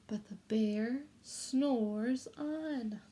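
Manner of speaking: very slow, drawn-out chant; slow sing-song refrain for children to repeat. Shown as a demonstration. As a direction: stretch every word so the kids can join in. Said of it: "this is your turn".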